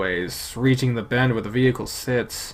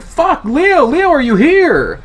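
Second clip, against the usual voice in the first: high, loud frantic call; frantic shout; 10 dB louder, slower than usual. Acted. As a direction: yell for your friend in a panic. Calling out for friend.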